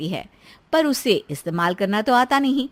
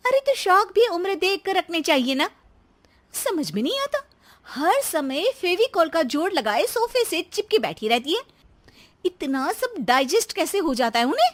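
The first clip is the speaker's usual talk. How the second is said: high-pitched, swooping wife's complaint; exasperated daughter-in-law; 8 semitones higher than usual. Acted. Wife griping to husband about his mother's appetite, exasperated. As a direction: scoff in a raised pitch.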